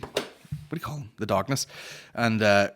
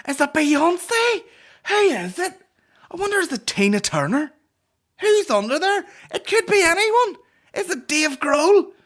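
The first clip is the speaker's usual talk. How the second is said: shrieky high, loud guessing; overexcited panellist firing off wild guesses; mock excitement; 9 dB louder than usual. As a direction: send up hysterical TV hype in falsetto. Mimics excited TV show guessing.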